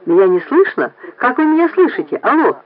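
slightly annoyed caller; somewhat irritated. A narrator's reading. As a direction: check the line with some impatience. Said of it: "несколько раздражённо".

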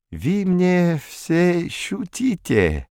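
put-on slow French accent, odd stresses; random word stress. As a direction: stress the wrong syllables. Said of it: "делая произвольное ударение".

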